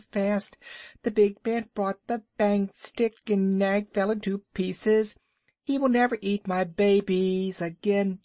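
high, chirpy bird voice boasting; little bird Darzee. A narrator's reading. Darzee boasting to Rikki about Nag's death, high and chirpy. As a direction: puff up like a proud tiny bird.

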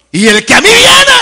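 soaring, loud proclamation; preacher's full shout. Acted. Preacher quoting Jesus' promise to the church, high ringing shout. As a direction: cry it out high at full force.

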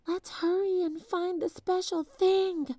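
a hint of a young girl whispering urgently; whispered girl voice. A narrator's reading. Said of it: "whispered Annie".

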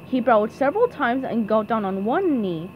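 ordinary delivery, nothing marked

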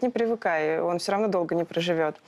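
ordinary talk, nothing marked

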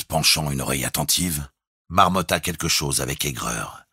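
ordinary delivery

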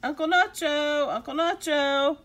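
loud, high, excited call; eager child's shout. Acted. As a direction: holler for someone indoors. Boys calling for Uncle Nacho.

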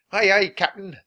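high, loud crew reply; eager pirate sidekick voice; pirate henchmen. A narrator's reading. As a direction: answer the captain smartly as his underlings. Pirate crew answer their captain.

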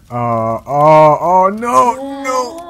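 rising to a high, loud wail of no; hammed-up despair, climbing to a shriek; mock anguished cry. Gamer hams up grief as his game wife dies, wailing high.

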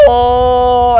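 long loud jungle yell; Tarzan yell. Real, not acted. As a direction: bellow it like Tarzan. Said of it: "I sound like Tarzan".

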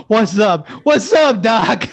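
high, laughing rabbit voice; laughing Bugs imitation. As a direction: laugh through the cartoon line. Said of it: "I don't think I've ever had anybody go".

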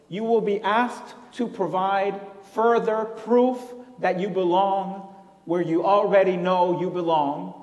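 ordinary talk

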